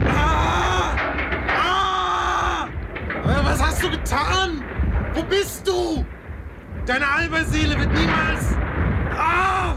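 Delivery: high pained cries; screaming in pain. Acted. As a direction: scream through the agony.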